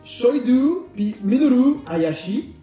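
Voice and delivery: names said slowly and high in a Japanese accent; slow, careful Japanese accent on the names; Japanese accent in French. Shown as a demonstration. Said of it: "pardonnez-moi mon français". (accent japonais appuyé)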